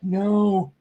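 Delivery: high drawn-out mock protest; a pained, high-pitched no; mock audience groan. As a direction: imitate listeners begging you to stop. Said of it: "as you're like".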